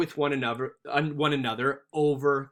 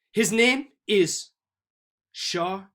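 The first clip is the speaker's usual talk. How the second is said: put-on French accent; 5 semitones higher, 4 dB louder than usual. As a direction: imitate a French accent, pausing between syllables. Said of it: "How do you perfectly pronounce this?".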